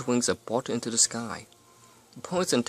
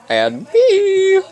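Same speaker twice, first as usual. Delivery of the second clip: very high, loud startling shout; sudden high-pitched holler; 12 dB louder than usual. Acted. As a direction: burst in with a piercing yell. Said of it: "shouted a voice behind her".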